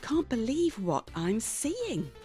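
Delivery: ordinary delivery, nothing marked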